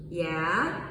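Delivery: "Yeah" low and rising. Shown as a demonstration. Teacher comparing tones: rising "Yeah". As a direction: lift "Yeah" like a question. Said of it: "It has that same thing".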